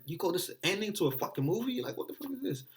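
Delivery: high, outraged rant at the movie; mock rage. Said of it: "it was like".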